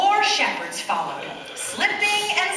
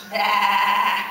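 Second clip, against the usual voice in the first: lamb bleat; 3 semitones lower, 3 dB louder than usual. Acted. Sheep bleat imitation.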